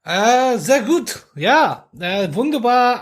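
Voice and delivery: hammed-up German accent, pitched high at the start; comic mock-German delivery; terrible German accent. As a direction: put on a bad, playful German accent. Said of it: "I'll save you my terrible German accent".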